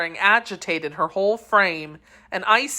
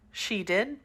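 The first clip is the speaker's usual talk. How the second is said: weak reply; 3 semitones higher, 8 dB quieter than usual. A narrator's reading. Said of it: "dying Constance answers weakly".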